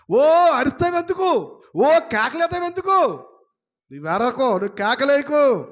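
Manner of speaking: God's voice scolding a wailing sinner, loud; scolding shout. Acted. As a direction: shout down someone crying out. God scolding the crier.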